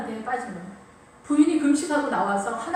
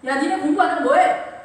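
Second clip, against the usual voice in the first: loud, higher mock scolding; scolding father; 5 semitones higher, 7 dB louder than usual. Sulky father taking it out on his children, mock scolding. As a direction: snap at the kids like a grumpy dad.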